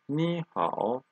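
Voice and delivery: ni rising in second tone before a third-tone hao; tone change demo. Shown as a demonstration. Teacher shows two third tones together, ni lifted to second tone. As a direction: let ni climb, then dip on hao. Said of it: "This becomes second tone".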